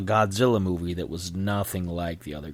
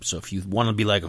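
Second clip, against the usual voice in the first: ordinary talk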